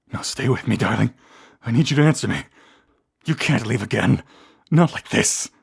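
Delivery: loud, urgent plea; desperate pleading. Acted. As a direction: beg a fading loved one to stay. Pleads with a fading loved one.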